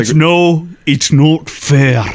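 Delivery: put-on slow, drawn-out Scottish accent; mock Scottish protest. Arguing over favorite temples, hammed-up Scottish accent. Acted dad and kid temple quarrel.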